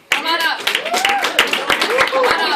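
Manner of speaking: loud, high cheering call; excited shout, stretched out on the repeat. Real, not acted. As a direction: holler them up over the applause. Host calls winners up front.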